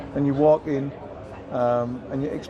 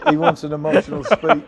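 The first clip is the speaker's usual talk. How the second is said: chuckling through the words; a real laugh after a louder outburst; 11 semitones higher, 6 dB louder than usual. Real, not acted. Laughs at interviewer's teasing setup.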